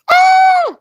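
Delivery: sudden high yelp. Retold or imitated. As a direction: yelp like a startled kid. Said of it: "he goes".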